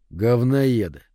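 ordinary delivery

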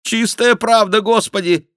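high, loud repentant whine; put-on contrite confession; penitent mock king. A narrator's reading. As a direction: repent loudly on the king's behalf. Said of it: "каялся Шико от имени короля".

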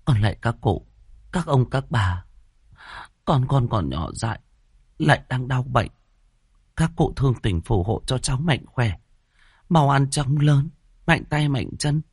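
high, pleading prayer to ancestors; praying. A narrator's reading. Mother prays at altar for sick baby, pleading prayer. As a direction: entreat in measured, high-pitched phrases. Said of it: "bước ra ban thờ ra tên mà khấn".

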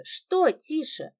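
Что тише drawn out slowly, each word weighed; slow mocking repeat. A narrator's reading. Lida mocking the brother's hush, slow and pointed. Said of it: "slowed repetition".

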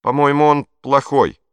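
put-on loud, trembling boy's voice; boy nervously accusing the cake before the Führer. A narrator's reading. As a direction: force it out though scared. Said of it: "Голос Пьеро дрожал".

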